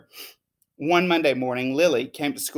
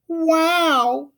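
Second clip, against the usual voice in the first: put-on high, breathless little-girl wow; a child struck speechless; Lily's amazed wow; 14 semitones higher than usual. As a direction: gasp it out in pure wonder. Lily amazed by teacher.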